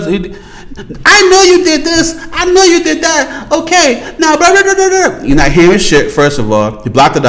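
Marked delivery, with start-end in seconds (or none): 0.0-5.2 high accusing woman. Retold or imitated. Imitating the woman confronting him, high and accusing. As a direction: list his sins back at him in a high mocking woman's voice. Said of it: "Now she came up".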